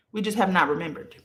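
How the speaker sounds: fast, tossed-off restatement of "We just have not remembered"; quick repeat. Shown as a demonstration. To her live viewers, a quick repeat.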